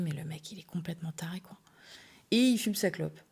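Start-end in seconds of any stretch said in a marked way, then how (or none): 0.0-1.6 a stunned whisper to herself; whispered thought. Retold or imitated. Getting dressed, she whispers her shock to herself. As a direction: mutter it under the breath in disbelief.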